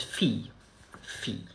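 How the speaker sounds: "fille" as a soft "fee-y", no final vowel; silent final E. Shown as a demonstration. Teacher showing a silent ending, "fille" without the final E. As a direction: say "fille" quietly, letting it fade without an E. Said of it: "You don't pronounce the final E".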